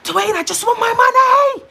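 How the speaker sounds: high, loud desperate yell of his name; a pleading scream for the money owed; retold shout. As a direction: shout it at him like you've lost it. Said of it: "And I was like".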